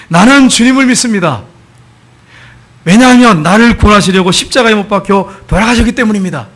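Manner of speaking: imitating a man shouting in an empty church; loud declaration. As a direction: cry out a confession of faith. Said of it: "이걸 큰 소리로 외쳤어요".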